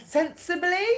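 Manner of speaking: high-pitched, breaking into a laugh. Real, not acted. Reader losing composure over her own advice, laughing. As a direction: giggle through the word. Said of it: "I don't know why I'm laughing".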